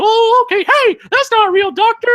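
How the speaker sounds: very high, loud squeaky protest; Mister Bill voice. Retold or imitated. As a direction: whine it like a small frightened puppet. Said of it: "the Mister Bill voice".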